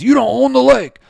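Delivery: high, mocking You don't own the lake; whiny high mock quote. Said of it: "always says".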